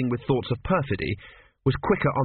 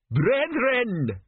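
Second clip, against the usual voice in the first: high, ringing preacher's call; grand, high-pitched proclamation; crying out; 12 semitones higher than usual. A narrator's reading. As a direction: address the crowd like an orator. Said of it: "he cried".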